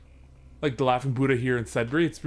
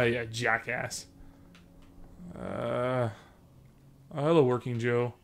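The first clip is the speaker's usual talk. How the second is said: ordinary delivery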